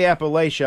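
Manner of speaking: Appalachia with "LAY-sha" at the end; disfavored pronunciation. Shown as a demonstration. Warning against saying it so, Appalachia as "appa-LAY-sha". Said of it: "the correct is Appalachia".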